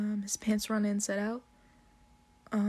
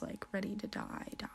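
whispered; 12 dB quieter, faster than usual. Retold or imitated. Quoted thought about the phone.

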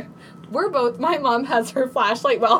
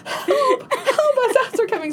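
much higher, laughing mock scream; frantic cry for help; 13 semitones higher than usual. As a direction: call to the police through the window. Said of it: "me downstairs crying".

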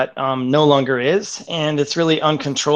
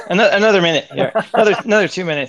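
laughing; 6 semitones higher, 5 dB louder, much faster than usual. Real, not acted. Laughing while buying more time.

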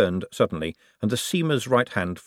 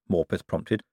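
usual speaking voice

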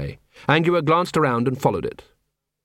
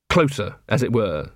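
ordinary delivery

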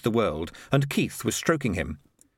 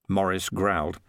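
plain reading voice

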